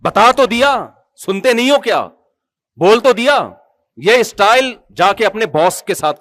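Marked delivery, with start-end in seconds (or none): 0.0-2.1 higher, louder irritated snapping; snapping at parent. Retold or imitated. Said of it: "ایسے جو style نہیں ہوتا ملازموں والا". 2.8-3.5 higher, louder irritated بول تو دیا; snapping. Retold or imitated. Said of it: "ایسے جو اسٹائل نہیں ہوتا ملازموں والا".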